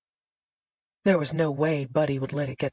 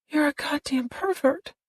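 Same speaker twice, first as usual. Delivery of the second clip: much higher, accusing; girl calling him a pervert, higher; angry; 10 semitones higher than usual. A narrator's reading.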